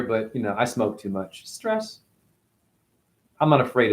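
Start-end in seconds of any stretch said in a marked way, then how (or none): 0.0-2.0 strained, throat catching from ginger; throat irritated. Real, not acted. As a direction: talk past a choking throat. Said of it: "pardon me choking on ginger".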